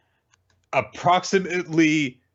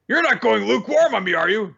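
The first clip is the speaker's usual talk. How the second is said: pushy; 8 semitones higher, 3 dB louder, faster than usual. Retold or imitated. Captain pushing Mash.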